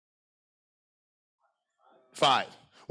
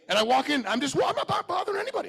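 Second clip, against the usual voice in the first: usual speaking voice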